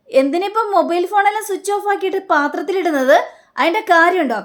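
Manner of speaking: high, baffled protest; why on earth would we do that. Retold or imitated. Family baffled by the order to hide their phones.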